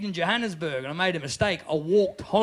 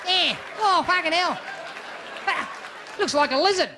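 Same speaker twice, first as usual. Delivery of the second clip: raised-voice, broad Aussie chat-up; crass Aussie accent; 8 semitones higher than usual. Acted. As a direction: holler it like a crude Aussie bloke. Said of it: "Doesn't work if you're an Aussie".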